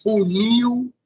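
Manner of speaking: "funil" high, with a stretched -NIL; attention-drawing final stress. Shown as a demonstration. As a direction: model "funil" as foo-NEEEW, pitch lifted. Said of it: "atenção".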